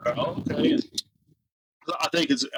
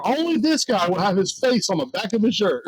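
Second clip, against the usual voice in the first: high, animated imitation of exclaiming; Joey; 9 semitones higher, 3 dB louder than usual. Customer telling the coworker about the shirt, imitated as high, animated exclaiming. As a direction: imitate a friend crowing in amused disbelief, pitch raised. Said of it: "He goes".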